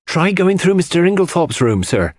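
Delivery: an anxious servant calling out; crying out. A narrator's reading. The maid Dorcas cries to John at the locked door. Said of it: "cried Dorcas".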